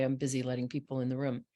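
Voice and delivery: usual speaking voice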